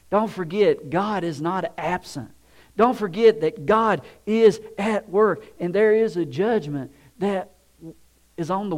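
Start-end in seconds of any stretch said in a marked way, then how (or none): none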